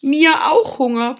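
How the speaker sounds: put-on high, loud toddler voice; squeaky little one. A narrator's reading. Youngest rabbit joining the hunger complaint, squeaking. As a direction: pipe up like the smallest child. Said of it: "piepste die Kleinste".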